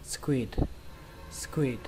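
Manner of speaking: squid ending on an audible D; "squid" with a silent U and a released final d; final D sound. Shown as a demonstration. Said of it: "kết thúc bằng âm D".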